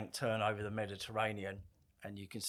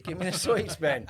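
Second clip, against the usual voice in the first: higher and louder stern order; stern demand; 7 semitones higher, 7 dB louder than usual. Retold or imitated. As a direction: snap it as the second telling. Second, sterner demand for sweets.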